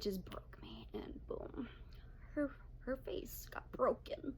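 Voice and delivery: hushed whispered confession. Real, not acted. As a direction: drop to a whisper.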